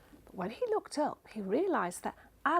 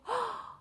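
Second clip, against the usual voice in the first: frightened gasp; 10 semitones higher, 3 dB quieter than usual. Acted. Mum frightened.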